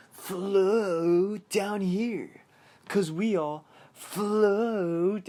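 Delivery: high, loud, drawn-out clown call; Pennywise saying float weird. Retold or imitated. Clown luring Richie down in the cellar, weird high wail. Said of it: "he says float all weird".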